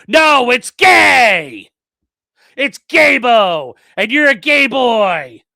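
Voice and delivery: slow, loud, high taunting shout. Retold or imitated. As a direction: yell the taunt slowly. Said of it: "He'll be like".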